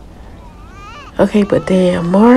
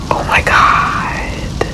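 whisper; much slower than usual. Real, not acted. Shocked at the Grim Reaper.

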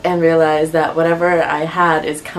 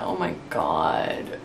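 low, slow and much softer; weary groan; 13 semitones lower, 8 dB quieter, much slower than usual. Real, not acted. Sick vlogger sighing to viewers, low weary groan. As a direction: let it sink out of you. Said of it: "I'm just so sad".

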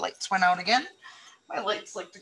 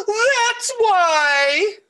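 high, loud mock sob of a little boy; crying child wail; 11 semitones higher, 5 dB louder than usual. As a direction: wail it out like an upset kid. Voicing crying Sam ending his rant.

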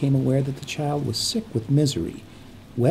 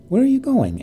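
much higher and louder, rising alarm; anxious, raised question to silent strangers; 12 semitones higher, 6 dB louder than usual. Acted. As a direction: push the question up, anxious and loud. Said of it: "her voice rising".

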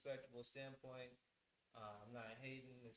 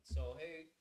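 loud, higher-pitched host greeting; big projected welcome to listeners; show opener; 5 semitones higher, 10 dB louder than usual. Real, not acted. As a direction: kick off the podcast with volume. Host opens the podcast live.

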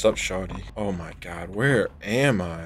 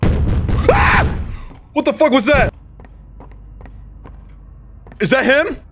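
frightened yell; slower than usual. Real, not acted. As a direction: yell at the sudden scare. Second scare, yells.

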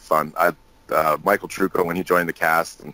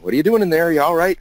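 host knocking on door; 11 semitones higher, faster than usual. Retold or imitated. Michael Reimer knocking on the bathroom door, a raised higher call. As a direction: call through a bathroom door, checking on a guest. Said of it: "Michael Reimer's knocking on the door".

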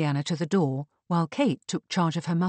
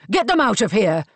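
loud, raised angry bellow; 5 semitones higher, 9 dB louder than usual. A narrator's reading. Marcus orders the visitors out of his house, bellowing. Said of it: "bellowed Marcus".

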